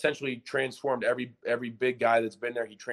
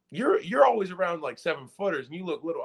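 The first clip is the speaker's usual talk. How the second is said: higher-pitched puzzled observation; mild retold surprise; 7 semitones higher than usual. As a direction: marvel lightly in a raised pitch. Retells his surprise at coach's height.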